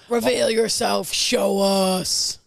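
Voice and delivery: loud, slow, hammed-up demand; mock aggressive command. Mate teasing his co-host to show her real face, hammed-up loud demand. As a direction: boom it out like a summoning. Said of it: "Maybe not that aggressively".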